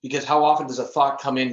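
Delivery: normal talking voice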